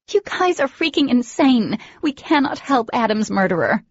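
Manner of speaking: high, loud outraged outburst; outraged shout. Acted. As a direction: shout it in disbelief at the group. Dee outraged at helping the murderer.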